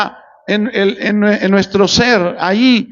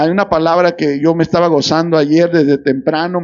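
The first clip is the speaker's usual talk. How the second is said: normal talking voice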